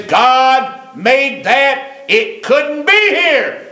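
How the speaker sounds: high, drawn-out preacher's cry; emphatic proclamation. Acted. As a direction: proclaim it to the heavens, stretching the words. Preacher voices every man's awed conviction.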